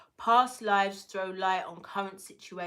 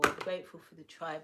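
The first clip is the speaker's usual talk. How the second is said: ordinary talk, nothing marked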